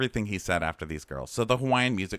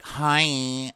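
put-on higher, cutesy baby-talk greeting; 5 semitones higher, 3 dB louder than usual. Contestant greeting a man in mock baby voice. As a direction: coo it like a little girl. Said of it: "She talks in baby voice".

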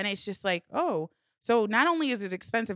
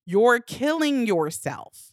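slow, louder voice in your head; warning inner voice; 3 semitones higher, 7 dB louder, much slower than usual. Retold or imitated. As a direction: drag it out as a loud self-warning. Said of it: "it's the voice in your head".

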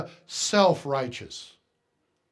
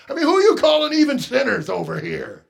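louder, indignant protest, higher pitched; offended churchgoer; 5 semitones higher, 8 dB louder, faster than usual. Retold or imitated. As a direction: bristle like a churchgoer stung by the word. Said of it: "Can't you just hear it?".

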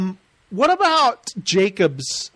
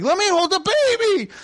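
high-pitched hammed-up shriek; shrieky, exaggerated outrage; mock angry demand; 12 semitones higher than usual. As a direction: imitate a girl losing her temper. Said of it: "she starts getting mad".

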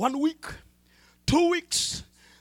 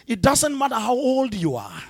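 louder, fervent preaching over music; preaching at full force; 3 semitones higher, 11 dB louder than usual. Acted. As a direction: build it up like an altar call.

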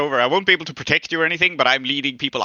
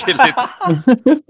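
laughing; 9 semitones higher, 6 dB louder than usual. Real, not acted. Cheering kill it, laughing.

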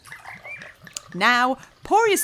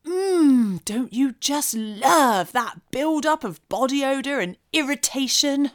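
ordinary talk, nothing marked

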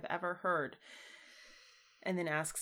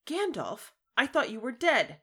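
higher, louder, fast breathless disbelief; gasping wonder; 5 semitones higher, 8 dB louder than usual. A narrator's reading. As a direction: read Sam overwhelmed with joy. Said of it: "At last he gasped".

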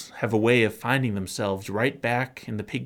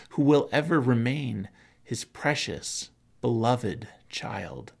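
ordinary delivery

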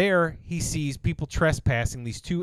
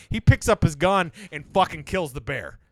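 ordinary delivery

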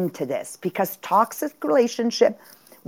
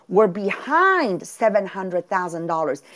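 ordinary talk

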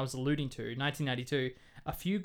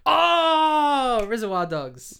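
high, loud sudden oh; surprised realization; 10 semitones higher, 10 dB louder, much slower than usual. Real, not acted. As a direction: gasp of recognition. Recognising the film.

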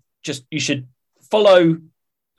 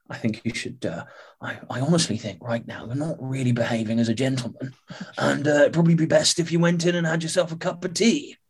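lightly Welsh, polite captain voice; a hint of a gentle Welsh captain; Welsh accent. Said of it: "That was so Welsh".

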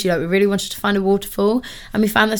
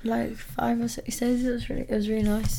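shattered; 3 semitones higher, 9 dB quieter than usual. Real, not acted. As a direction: talk like you're exhausted after the trip. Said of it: "You sound so shattered".

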